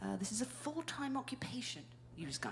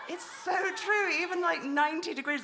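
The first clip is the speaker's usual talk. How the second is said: loud agreement; 8 semitones higher, 7 dB louder than usual. Real, not acted.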